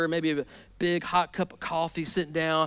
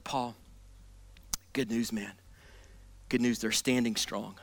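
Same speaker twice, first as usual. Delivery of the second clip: softer, lower, reassuring report; calm good news; 8 semitones lower, 9 dB quieter than usual. Retold or imitated. Timothy reports back to Paul, softer and lower. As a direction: gently break the good news. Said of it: "Timothy opens his mouth, and he's like".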